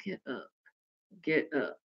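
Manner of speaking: low hushed pleading; whispering. Retold or imitated. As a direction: hush it out to a friend on the floor. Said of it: "I kept whispering".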